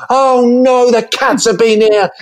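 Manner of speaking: high, panicky mock mum; mum panicking. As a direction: squeal it like a horrified mum. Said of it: "she was like".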